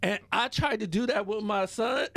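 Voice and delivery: usual speaking voice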